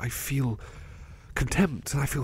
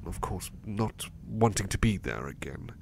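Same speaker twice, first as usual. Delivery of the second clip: ordinary talk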